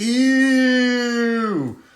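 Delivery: long, high, loud sustained wail; drawn-out vocal siren; imitated siren wail. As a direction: hold it like an old-style siren.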